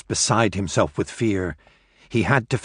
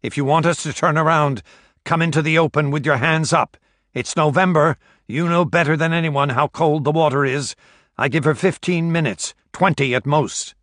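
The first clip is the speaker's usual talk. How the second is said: calling out; 6 semitones higher, 3 dB louder than usual. A narrator's reading. Demand shouted across the deck.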